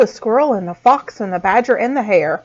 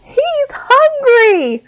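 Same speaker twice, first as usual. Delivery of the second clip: high, slow warning cry; shouting; 4 dB louder, much slower than usual. A narrator's reading. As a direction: shout the danger. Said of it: "shouted the squirrel".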